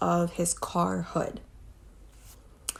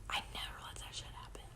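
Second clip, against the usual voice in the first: fast, far softer whisper, almost gone; faint whisper; 17 dB quieter, faster than usual. Real, not acted. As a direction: let it fade to a ghost of a whisper.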